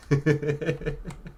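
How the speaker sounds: high, rapid little chuckle. Real, not acted. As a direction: laugh lightly at your own age. Chuckles about his own age.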